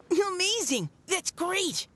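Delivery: high delighted cheer; high happy exclamation; excited. Acted. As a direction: burst out with joy. Delighted at decision.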